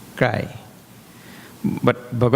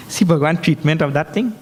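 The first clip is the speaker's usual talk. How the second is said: ordinary delivery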